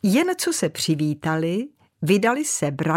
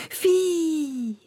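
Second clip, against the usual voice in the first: high whistle; 11 semitones higher than usual. Acted. Něco pískajícího proletělo.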